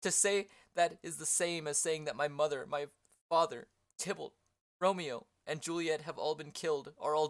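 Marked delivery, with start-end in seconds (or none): none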